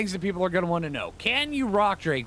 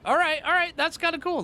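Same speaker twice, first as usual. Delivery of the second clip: pitched up high, delighted; a sudden burst of real delight; happily surprised by a cool feature; pleased excitement; 13 semitones higher, 3 dB louder than usual. Real, not acted.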